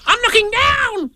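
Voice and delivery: very high, slow squealed call; high panicky little yell; loud Piglet cry. Acted. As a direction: cry it out in a tiny shrieking voice. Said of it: "cried Piglet loudly".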